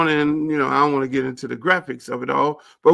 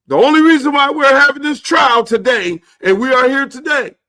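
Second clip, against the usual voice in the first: judge voice, loud then dropping; hammed-up judge demanding the dancers; mock judge; 7 semitones higher, 6 dB louder than usual. Judge in chambers telling Campbell his wish, mock loud.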